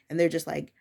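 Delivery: ordinary talk, nothing marked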